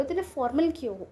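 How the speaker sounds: ordinary talk, nothing marked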